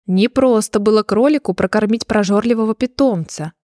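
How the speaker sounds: usual speaking voice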